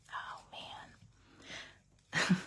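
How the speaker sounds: breathy hushed awe at the card; excited whisper. Real, not acted. Admiring her finished card in an excited whisper. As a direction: whisper it, too thrilled to speak up. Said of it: "I'll whisper".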